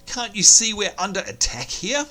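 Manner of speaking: high, alarmed little gnome yelling back; panicked gnome voice. Acted. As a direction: squeak it out like a flustered gnome under fire. Quest-giver gnome reacts in panic.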